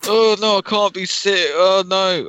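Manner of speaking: teacher insisting she's fine, hammed up; mock teacher insisting. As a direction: ham up the sick teacher who won't stay home. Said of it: "she was like".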